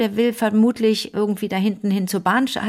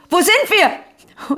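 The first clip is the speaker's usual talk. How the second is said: höher und lauter, langsam gedehnt gerufen; nachgemachtes Brüllen; 9 semitones higher, 4 dB louder, much slower than usual. Retold or imitated. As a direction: zieh die Frage gerufen in die Länge. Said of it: "Und er brüllt". (nachgeahmter Ruf in die volle Bahn, gedehnt)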